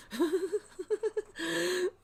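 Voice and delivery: a burst of high squealing laughter. Real, not acted. Reading a viewer's joke, bursts out in high laughter. Laughs at Hitler or Chaplin comment.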